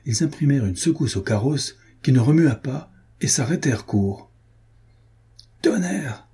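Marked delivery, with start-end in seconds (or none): 5.6-6.3 high-pitched exclamation of dismay; cried oath. A narrator's reading. Coachman finding the horses cut loose, high cried oath. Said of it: "cria le cocher".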